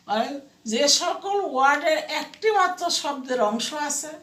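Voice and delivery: ordinary talk, nothing marked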